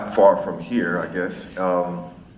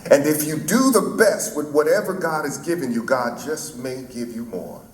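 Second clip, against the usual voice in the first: Martin Luther King; 4 semitones higher than usual. Retold or imitated. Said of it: "he said to the audience".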